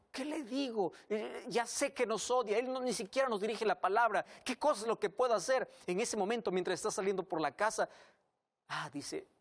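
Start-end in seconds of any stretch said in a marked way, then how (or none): 0.1-5.7 marido agobiado. Acted. Marido angustiado responde.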